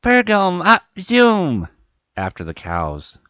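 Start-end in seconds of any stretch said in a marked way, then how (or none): 0.0-1.7 high, drawn-out screech; very loud, slow, high-pitched yell. A narrator's reading. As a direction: screech it out like a little child. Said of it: "began screeching".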